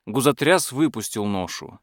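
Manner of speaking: ordinary delivery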